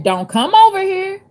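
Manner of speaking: high, loud, firm warning; authoritative voice. Shown as a demonstration. Woman warning a man crossing the street, authoritative. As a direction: stop a would-be thief in his tracks. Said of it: "I said it just like that. That's how an authoritative voice".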